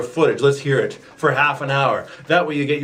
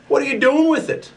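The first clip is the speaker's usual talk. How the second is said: high accusing whine; 12 semitones higher, faster than usual. Acted. Accusing rival over belt.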